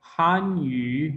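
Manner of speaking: hàn yǔ said slowly, each tone clear; Chinese word demo. Shown as a demonstration. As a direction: model हान यू slowly for the class. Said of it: "Chinese language".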